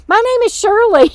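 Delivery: high mock shout; imitated loud announcement. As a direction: announce the name loudly as your sister. Sister announcing name to stranger.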